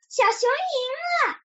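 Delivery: high, drawn-out announcing cry; loud cry. A narrator's reading. Duck announces that little bear has won, crying out high. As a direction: call out the winner in a stretched high voice. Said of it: "它大声叫道".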